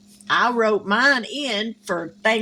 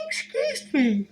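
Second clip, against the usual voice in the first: laughing apology; 5 semitones higher than usual. Real, not acted. Apologizing after a yawn.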